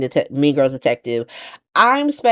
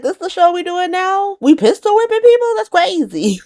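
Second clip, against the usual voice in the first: very high, loud disbelief; incredulous; 14 semitones higher, 4 dB louder than usual. Acted. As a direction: exclaim it in high-pitched outrage at the absurdity. Reacting to the pistol whipping.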